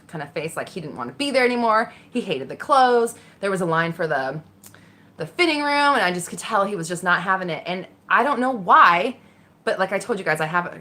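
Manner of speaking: ordinary delivery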